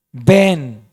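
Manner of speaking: deep commanding call; commanding Jesus. Acted. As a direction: call Peter out onto the water with authority. Jesus speaks to Peter.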